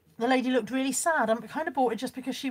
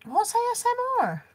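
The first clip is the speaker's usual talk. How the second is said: baffled; 11 semitones higher than usual. Real, not acted. Confused by chat request.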